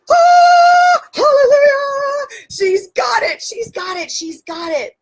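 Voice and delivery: high, drawn-out singing hallelujah fading into giddy relief; hallelujah rejoicing. Retold or imitated. Coach celebrating her client's breakthrough, overjoyed. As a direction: belt a jubilant hallelujah, then marvel in a high voice.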